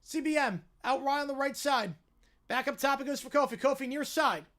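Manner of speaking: brisk, projected basketball commentary; live play-by-play. Real, not acted. Calling a basketball possession for listeners, live play-by-play. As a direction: follow the ball down the court, punching key words.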